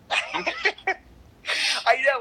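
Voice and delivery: teary laugh, then I know; laughing through tears; choked-up laughter; laughing and crying. Real, not acted.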